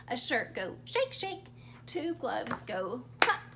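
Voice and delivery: ordinary delivery, nothing marked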